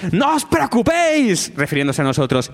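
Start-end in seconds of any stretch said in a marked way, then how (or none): 0.1-1.5 high, slow shout. Retold or imitated. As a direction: yell it across to the sound guy. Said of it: "les grita al técnico".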